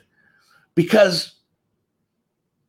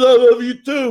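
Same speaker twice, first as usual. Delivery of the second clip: a louder, lightly voiced Rocky reply; 4 semitones higher, 7 dB louder than usual. Retelling the end of Rocky, louder hint of Rocky. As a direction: toss off a hint of Rocky Balboa. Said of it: "And Rocky says".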